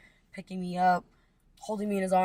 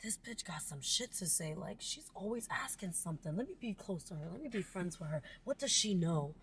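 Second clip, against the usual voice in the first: much softer, as if thinking privately; friends' intrigued musing; 10 dB quieter than usual. Retold or imitated. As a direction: voice curious onlookers in an undertone. Voices intrigued childhood friends' thoughts.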